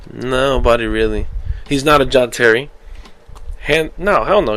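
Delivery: usual speaking voice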